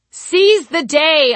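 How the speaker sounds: high, loud, very slow yell; triumphant yell. Acted. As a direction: bellow it in triumph. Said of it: "I yelled".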